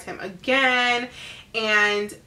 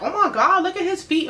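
high, laughing taunt; giggling schoolyard mockery; mocking laugh; 8 semitones higher, 3 dB louder than usual. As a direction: laugh at him while pointing out his feet. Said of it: "making fun of him".

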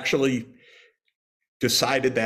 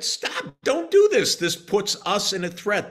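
alarmed protest; 11 semitones higher than usual. Retold or imitated. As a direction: cry out the objection on someone's behalf, pitched way up. Voices the Russians' protest.

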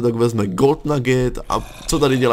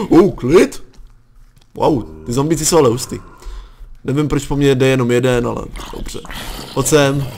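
usual speaking voice

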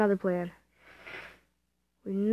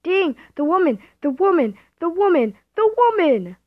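loud, high repeated yell at being killed; outraged yell; 8 semitones higher, 11 dB louder than usual. Real, not acted. Killed in a game, yelling at the woman who did it. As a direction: shout the woman over and over in disbelief.

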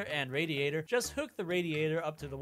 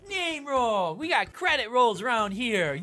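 hype shout; 5 semitones higher, 6 dB louder, slower than usual. Pretend. Hyped credit announcement.

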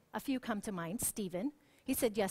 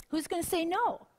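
higher, louder, disbelieving who's gonna say no; incredulous question; 7 semitones higher, 4 dB louder than usual. Retold or imitated. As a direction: toss it out like it's absurd. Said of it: "you might be sitting there saying".

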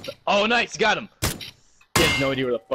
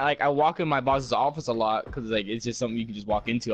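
normal talking voice

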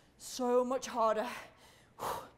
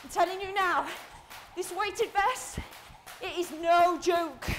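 loud, raised voice under effort; emphatic coaching; 4 semitones higher, 4 dB louder than usual. Real, not acted. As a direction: drive it home, loud and drawn out. Coach exerting mid-set, calling out.

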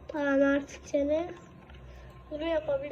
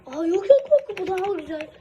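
loud burst over a better item; excited; 3 semitones higher, 8 dB louder than usual. Real, not acted. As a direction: exclaim it, thrilled.

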